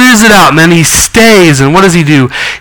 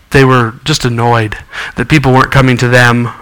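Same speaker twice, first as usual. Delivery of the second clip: ordinary delivery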